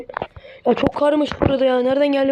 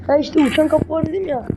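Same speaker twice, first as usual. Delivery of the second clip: ordinary talk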